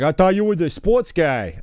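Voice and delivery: put-on heckling parent with a Boston-area accent; mock Masshole accent; Massachusetts accent. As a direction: needle the coach in a thick Massachusetts accent. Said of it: "surrounded by, uh, massholes".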